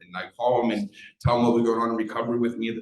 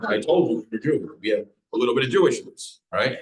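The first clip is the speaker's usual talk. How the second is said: higher, louder retold told-you-so; retold uncle's told-you-so; 4 semitones higher, 6 dB louder than usual. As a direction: lightly play the insistent uncle. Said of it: "My uncle writes back".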